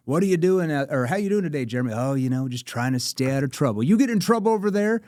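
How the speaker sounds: playful sales banter. Shown as a demonstration. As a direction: joke around with them. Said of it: "I use a playful tone".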